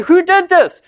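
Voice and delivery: high, loud demand; outraged question. Retold or imitated. Philistines demanding who burned the fields, outraged.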